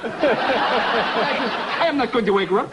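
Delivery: slow, stubborn imitated French accent; mock French accent, each word deliberate; French man refusing. Frenchman refusing the caller, slow imitated French accent. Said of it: "Again, I hear".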